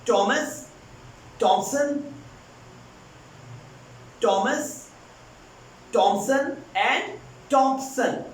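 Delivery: "Thompson and Thompson" drawn out very slowly; very slow names. Shown as a demonstration. Teacher drills the names slowly. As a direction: spell out each "Thompson" slowly. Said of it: "There are three names".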